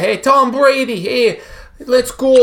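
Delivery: put-on high loud Boston accent cheer; Boston fan yell. Cohost cheers Tom Brady as a Boston fan, loud and high.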